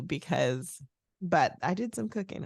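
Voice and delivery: ordinary delivery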